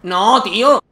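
shouted frustration at dying; loud dismayed cry. Real, not acted. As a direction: yell out in defeat. Dies again in the game.

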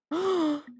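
high, sudden gasp. Real, not acted. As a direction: gasp in surprise. Reacts to new game round.

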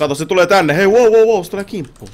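high, loud panicked wow; alarmed shout. Real, not acted. Warning friend as a mob charges, alarmed shout. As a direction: yell as it charges you. Enemy attacking them.

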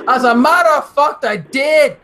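loud, high mock-Scottish retort; Scottish accent. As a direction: answer proudly in a put-on Scottish brogue. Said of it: "I haven't used Scottish in a while".

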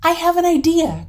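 high, loud burst of inspiration; excited cry. A narrator's reading. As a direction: cry out with a sudden idea. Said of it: "cried his wife".

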